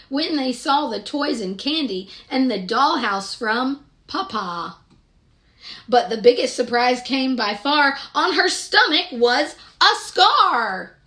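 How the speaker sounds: ordinary delivery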